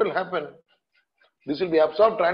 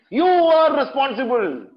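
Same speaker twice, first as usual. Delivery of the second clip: high, loud, slow accusation; accusing shout; 9 semitones higher, 7 dB louder, slower than usual. Real, not acted. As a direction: slam the blame on them slowly. Lecturer accusing the vet.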